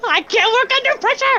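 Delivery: high-pitched, flustered outburst; real flustered squeak of complaint; flustered protest. Real, not acted. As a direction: protest in a high voice under pressure. Player flustered at being hurried.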